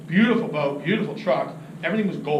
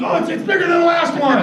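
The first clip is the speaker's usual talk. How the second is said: high, loud, stammering excitement; imitated overexcited angler; thrilled exclamation; 9 semitones higher, 7 dB louder than usual. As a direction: blurt it out in amazement. Friend thrilled by bigger fish.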